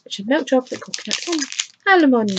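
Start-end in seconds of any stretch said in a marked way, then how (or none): 1.9-2.3 "almond" high and loud, sliding down; oddly said almond. Real, not acted. Unboxer reading out chocolate bar ingredients, oddly exaggerated "almond". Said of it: "Don't know why I said it like that".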